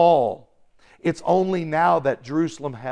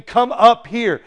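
raised, higher commanding call; loud heavenly voice; 6 semitones higher, 7 dB louder than usual. Acted. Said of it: "a loud voice from heaven".

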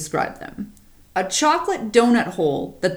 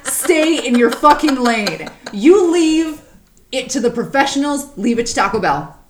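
loud emphatic scolding; 5 semitones higher, 4 dB louder than usual. Acted. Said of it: "and I say".

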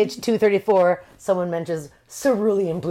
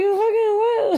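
high, stunned cry of disbelief; shocked disbelief; 12 semitones higher, slower than usual. Retold or imitated. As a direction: squeal it out in shock. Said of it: "And she's like".

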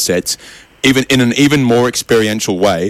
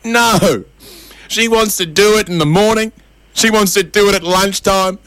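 high-pitched hammed-up wailing; mock crying; 8 semitones higher than usual. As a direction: wail each complaint like a weepy old man. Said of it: "The old man crying says".

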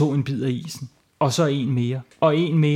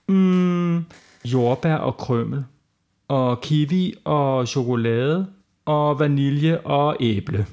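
slow savouring; much slower than usual. Acted. Child slowly picks ice cream flavours.

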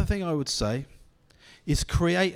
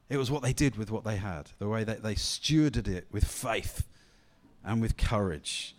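normal talking voice

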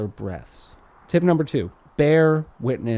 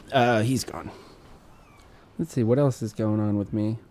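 usual speaking voice

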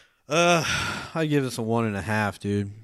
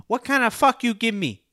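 higher mock broken English, hammed up; imitating the girl; 13 semitones higher, 4 dB louder than usual. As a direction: ask it in her high put-on voice. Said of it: "She's like".

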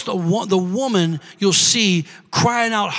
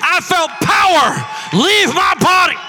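Jesus declaring; 4 semitones higher, slower than usual. Acted. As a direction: proclaim each word slowly as Jesus. Jesus voiced in story.

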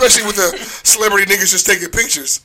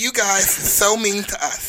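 slow, higher whine, mocking; mock whining complainers; 4 semitones higher, 5 dB quieter than usual. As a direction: draw out a put-on wounded complaint.

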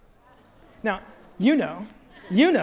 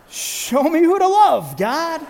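high, loud, drawn-out plea; exasperated prayer; 7 semitones higher, 7 dB louder, much slower than usual. Retold or imitated. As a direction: cry it out to heaven in mock desperation. Exasperated cry to God.

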